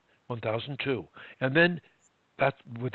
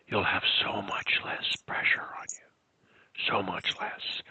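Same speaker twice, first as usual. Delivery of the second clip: slow, low, soft voice; slow down and drop to a hush; near whisper; 6 semitones lower, 6 dB quieter, slower than usual. Shown as a demonstration. Coach reassuring a stutterer by example, hushed voice. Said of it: "move your voice around a little bit".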